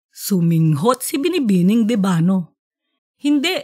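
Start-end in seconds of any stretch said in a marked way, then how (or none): 3.2-3.6 blurted out, a touch higher; sudden mild blurt. Acted. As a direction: cut in quickly with a mild no. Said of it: "na biglang sagot".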